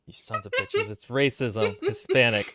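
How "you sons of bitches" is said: high squeaky mock outrage; a much higher, jokey cry of indignation. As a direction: pretend to be deeply offended. Joking outrage at being short.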